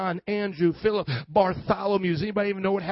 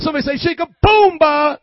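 booming rallying shout; 5 semitones higher, 11 dB louder than usual. Real, not acted. Preacher has the congregation say his catchphrase, booming high shout. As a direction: belt it out for the crowd to echo.

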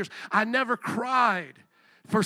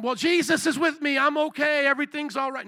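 raised-pitch forced cheer; high, bouncy put-on positivity; mock upbeat; 6 semitones higher, 4 dB louder than usual. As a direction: parody a believer insisting all is fine. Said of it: "feel like jumping up and down".

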